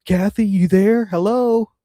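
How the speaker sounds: hammed-up husband calling into the phone; mock needy husband. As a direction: call out like a clingy husband checking she's still there. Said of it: "And he's just like".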